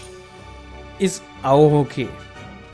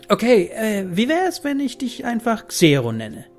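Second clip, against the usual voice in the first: put-on high, hesitant girl voice; a girl finding a nickname; young girl voice; 7 semitones higher than usual. Girl names the robot.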